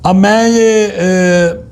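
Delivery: ordinary talk, nothing marked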